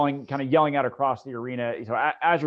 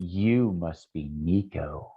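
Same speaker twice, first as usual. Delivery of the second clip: low, very slow villainess; menacing drow lady; 8 semitones lower, much slower than usual. Acted. Drow lady singles out her enemy by name, low slow menace. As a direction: draw it out deep and threatening. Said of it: "she'll look at you and she goes".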